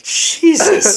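hammed-up alarm at a friend; mock shock. Teller reacts to confrontational friend, in exaggerated shock.

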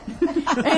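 a quick real laugh; laughing at the mask remark; short laugh. Real, not acted. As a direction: laugh it off lightly.

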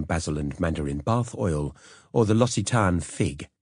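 ordinary delivery

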